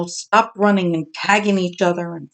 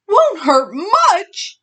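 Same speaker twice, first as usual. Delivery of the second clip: high, slow alarmed echo; frightened cry; 11 semitones higher, much slower than usual. Acted. Bully hearing it may hurt, frightened cry. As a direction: repeat it in dismay. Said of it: "Bully cried".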